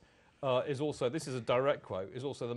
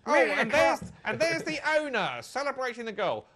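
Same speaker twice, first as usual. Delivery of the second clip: high, loud, hammed-up match commentator; mock football commentator; 12 semitones higher, 9 dB louder than usual. Host mocking what commentators say, as a hammy commentator. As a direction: ham up the excitement of a TV commentator. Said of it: "they should say".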